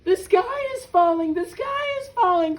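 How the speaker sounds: loud, high hen cry; crying out in alarm. A narrator's reading. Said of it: "cried Henny Penny".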